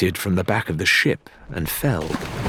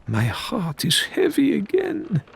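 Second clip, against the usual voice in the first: higher, slow, theatrical sorrow; mock heavy-hearted Faisal; 10 semitones higher than usual. A narrator's reading. Faisal lamenting to the prisoners, mock sorrowful. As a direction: ham up the wounded innocence.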